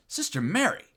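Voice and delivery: high-pitched scandalized reproach; mock-shocked clergyman scolding a nun; shocked bishop. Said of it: "is shocked".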